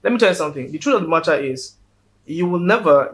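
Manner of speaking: ordinary talk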